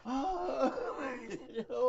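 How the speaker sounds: laughing through the words; can't stop laughing while telling it; high laughing retelling. Real, not acted. Laughing at memory.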